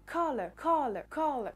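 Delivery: "color" in a British accent, stressed on the first syllable; British pronunciation of "color", repeated as a model. Shown as a demonstration. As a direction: say "color" the British way, falling at the end. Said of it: "Si je le prononce avec l'accent britannique".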